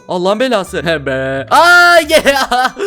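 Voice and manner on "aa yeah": loud laughing whoop; triumphant cheer. Real, not acted. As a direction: cheer with relief. Escapes, cheering.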